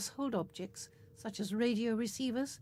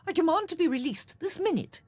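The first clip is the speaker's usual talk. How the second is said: higher and louder, affronted; indignant demand; 7 semitones higher, 7 dB louder than usual. A narrator's reading. Doctor demands Bernie release him, loud indignant. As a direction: insist on release with outrage. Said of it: "he said".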